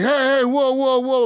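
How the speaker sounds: high startled calls of whoa, pitched well up. Retold or imitated. Stranger startled by another player, high alarmed whoa. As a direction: jump back with your hands up.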